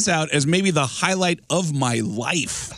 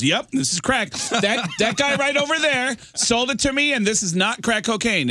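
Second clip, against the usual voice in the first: higher, laughing, hammed-up complaint; mock indignant woman; 8 semitones higher than usual. Woman insists to cop she was sold fake crack, hammed-up indignant. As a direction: play her outraged and clueless, going up high. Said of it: "And she goes".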